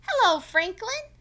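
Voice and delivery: high, loud, slow cheery hello; Mister Mole's greeting. Acted. Mister Mole greeting Franklin, high and cheery.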